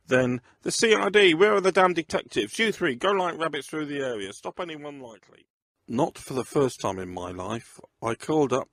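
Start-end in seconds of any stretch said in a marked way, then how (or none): 0.6-5.5 barked command. Acted. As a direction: demand the detectives at once. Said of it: "he barked".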